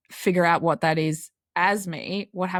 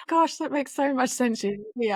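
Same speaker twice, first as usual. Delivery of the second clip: higher, sugary mock-soothing copy; imitating the nice voice; 7 semitones higher than usual. Guest teasingly copying the host's soothing voice, lightly higher. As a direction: play back her gentle tone in fun. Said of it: "You sound so nice".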